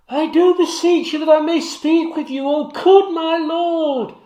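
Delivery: high, loud pleading at the door; high urgent woman's voice. Acted. Maid pleading through the door to her lord, high and urgent. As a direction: beg to be let in, high and loud.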